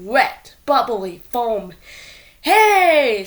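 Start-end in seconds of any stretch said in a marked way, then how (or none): 2.4-3.2 high, loud call; fan shouting. A narrator's reading. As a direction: bellow across the stadium. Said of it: "shouted a fan in the bleachers".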